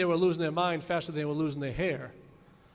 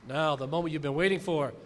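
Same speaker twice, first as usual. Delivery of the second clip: ordinary talk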